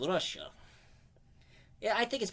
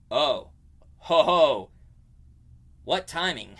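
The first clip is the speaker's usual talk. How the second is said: a hint of a breezy hero, louder on the ho ho; cocky hero quip; 9 dB louder than usual. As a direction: toss it off like a daredevil enjoying the moment. Voicing Flash's quip mid-jump.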